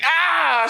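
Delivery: put-on high-pitched old-lady yelp; hammed-up cry of effort; mock strained cry. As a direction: imitate grandma straining. Imitates grandmother straining while raking.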